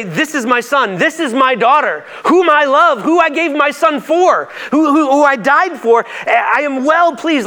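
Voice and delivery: high, loud, slow proclamation of love; fervent. Acted. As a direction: declare it like God's own voice, lingering on each phrase. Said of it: "hear the voice of God at this moment say".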